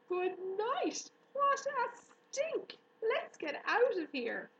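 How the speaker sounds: very high, loud scream. Acted. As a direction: shriek it, gagging at the stink. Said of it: "screamed Avery".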